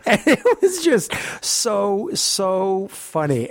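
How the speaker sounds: slow, high, laughter-soaked words; real laughing tail-off. Real, not acted. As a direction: still cracking up while telling it. Still laughing at the story.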